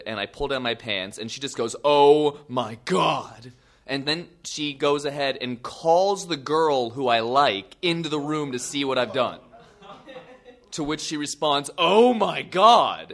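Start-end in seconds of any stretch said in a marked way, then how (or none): 1.8-3.5 loud mock-horrified "oh"; appalled onlooker, hammed-up; shocked reaction. Girl reacts to the wound. 11.8-13.1 slow, loud, high-pitched horror; hammed-up appalled gasp of words; horrified reaction. Crush reacts to the wound.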